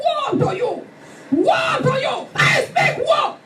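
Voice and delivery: fierce rising shout; shouting a curse. Retold or imitated. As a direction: cry out woe at them like a war cry. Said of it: "I start to shout".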